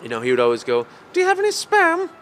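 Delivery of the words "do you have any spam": much higher, louder silly falsetto; Monty Python voice. Retold or imitated. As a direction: squeak it out like a silly Python lady. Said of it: "He would do the Monty Python voice".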